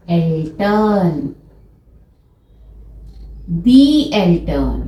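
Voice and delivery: slow die Eltern; German model word. Shown as a demonstration. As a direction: say Eltern clearly for learners. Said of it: "German में इन दोनों को कहा जाता है".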